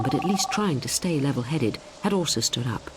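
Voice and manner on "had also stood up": ordinary delivery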